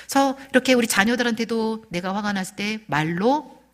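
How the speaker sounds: ordinary delivery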